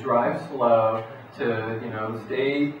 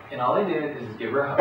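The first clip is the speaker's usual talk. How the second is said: ordinary talk, nothing marked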